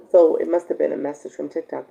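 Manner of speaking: ordinary talk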